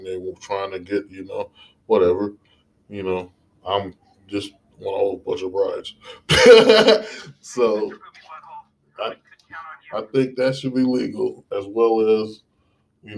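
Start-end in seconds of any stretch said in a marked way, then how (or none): 6.0-7.2 high, loud burst of laughter; sudden self-amused cackle; short laugh. Real, not acted. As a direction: burst out laughing at your own joke. Laughs at own bride remark.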